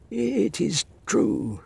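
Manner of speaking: high, slow grudging admission; grudging old man. A narrator's reading. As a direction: concede it reluctantly. Master concedes after looking out.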